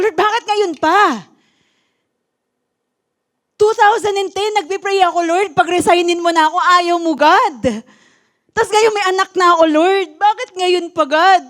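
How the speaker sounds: anguished plea to the Lord; distressed plea. Retold or imitated. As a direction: cry out to God in frustration. Same question retold with rising distress.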